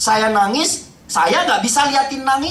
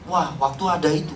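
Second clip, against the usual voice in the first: ordinary delivery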